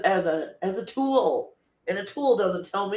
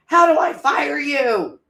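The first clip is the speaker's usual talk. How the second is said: mock exasperated shout; 9 semitones higher, 6 dB louder, slower than usual. Retold frustration with the AI.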